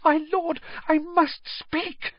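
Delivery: high panicked servant; panicky. Acted. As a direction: panic breaking into his words. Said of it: "panic in his voice now".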